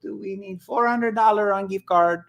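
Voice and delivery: high-pitched mock Indian call-centre scammer; Indian scammer accent. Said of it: "tech support Indian scammer".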